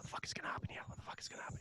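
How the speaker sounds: panicked hushed whisper. Retold or imitated. Comic whispers his dread about being summoned, hushed whisper. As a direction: breathe it out in nervous dread. Panicked inner thought in hallway.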